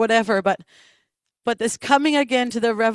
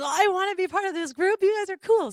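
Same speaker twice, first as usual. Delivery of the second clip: higher, eager imitated plea; eager friend; 9 semitones higher than usual. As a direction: play the keen guy wanting in. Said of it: "he goes".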